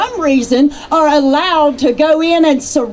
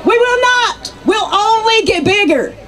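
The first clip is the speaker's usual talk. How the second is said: defiant rally cry; 5 semitones higher than usual. Real, not acted.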